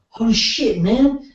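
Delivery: a clumsy mock Australian accent, pitched high; lightly hammed-up Australian accent; bad Australian accent. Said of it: "that was a bad Australian accent".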